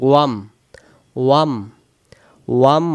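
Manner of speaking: "ওয়াম" as one syllable starting with w; joined wam. Shown as a demonstration. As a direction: close "ওয়াম" firmly on m. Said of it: "আম হবে না কিন্তু".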